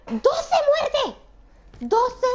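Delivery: high, loud, laughing; high laughing exclamation of disbelief. Real, not acted. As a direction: laugh at the scoreboard. Laughing at her death count.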